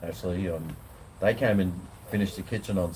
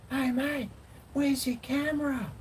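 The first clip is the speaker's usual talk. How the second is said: much higher, squeaky eager stranger voice; imitating a fan. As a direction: mimic the excited fan, way up high. Retelling a fan in the park.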